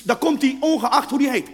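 usual speaking voice

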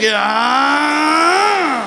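long drawn-out, loud gushing yes; loud, swooping fake enthusiasm; mock delight. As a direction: stretch it out in hammed-up rapture.

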